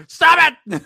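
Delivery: high, loud mock yell; hammed-up outraged yell; mock shout. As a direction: shout them down in jest. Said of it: "everybody was just like".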